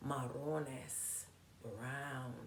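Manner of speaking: Marrones said again very softly; soft repeat. Shown as a demonstration. Teacher repeating Marrones softly for the kids. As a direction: say Marrones low and hushed, almost to yourself. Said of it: "Marrones is brown".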